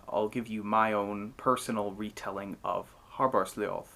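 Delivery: ordinary delivery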